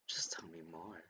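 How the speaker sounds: breathy secret whisper; low conspiratorial hush. Acted. Cinder speaks low to Emerald.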